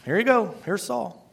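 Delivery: light, soft mock concession; God. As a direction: hand it over with a casual shrug. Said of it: "He's like".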